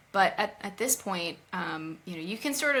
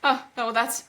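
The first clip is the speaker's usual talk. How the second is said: sarcastic; 4 semitones higher, 3 dB louder than usual. Retold or imitated. Continuing Cersei quote.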